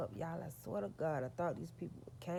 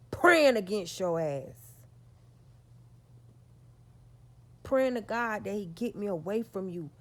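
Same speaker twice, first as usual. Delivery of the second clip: loud, fervent praying cry; fervent preaching; 3 semitones higher, 8 dB louder than usual. Real, not acted. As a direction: preach it to the back row.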